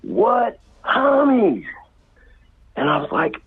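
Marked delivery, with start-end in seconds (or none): 0.0-1.7 drawn-out, higher mocking "What homies?"; slow mocking sneer. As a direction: stretch "homies" out slowly to mock him. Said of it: "And them fools said".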